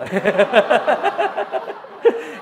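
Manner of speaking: a warm real laugh; loud laugh. Real, not acted. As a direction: laugh at his daughter's cheap taste. Laughs about cheap taste.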